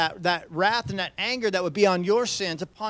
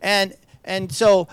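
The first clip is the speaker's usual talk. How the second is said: ordinary delivery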